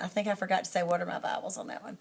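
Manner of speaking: quick whisper to the viewer; fast whispered self-correction; hurried whisper aside while demonstrating. Real, not acted. Aside during teaching demo.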